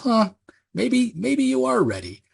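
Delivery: high, slow, knowing drawl; lightly voiced Cagliostro, amused; sly musing. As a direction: muse it like a smug old trickster. Cog thinks after being dropped.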